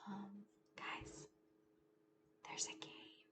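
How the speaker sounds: mock-scared whisper; spooky whisper. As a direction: hush the kids as if something lurks. Entering dark cave.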